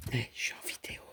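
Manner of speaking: whispered aside to the cat; soft whispered reminder. Real, not acted. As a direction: hush her, you're on camera. Whispering aside to cat while filming.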